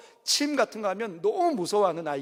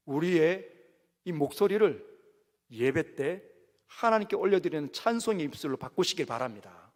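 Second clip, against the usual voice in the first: ordinary talk, nothing marked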